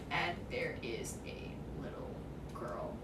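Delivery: near-whispered, breath-only narration; hushed reveal. Acted. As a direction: drop to an eerie whisper.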